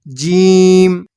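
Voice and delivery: जीम drawn out with the ी and final म clearly heard; a held, careful जीम, stressing the closing म; letter name demo. Shown as a demonstration. Teacher showing the sounds inside the letter, a slow clear जीम. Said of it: "मीम का तलफ़ुज़ शामिल कर दिया".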